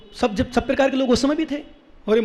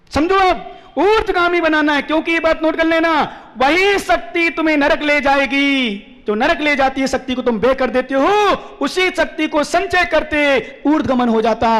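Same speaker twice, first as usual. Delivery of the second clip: raised, drawn-out preacher's delivery; ringing exhortation to a congregation; emphatic preaching; 4 semitones higher, slower than usual. Real, not acted. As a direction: preach it forcefully, stretching the key words. Preaching at full force.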